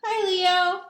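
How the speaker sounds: high, loud cheerful hello; chirpy kid greeting. Acted. Sam greets his monster friend Leo, cheerful kid voice.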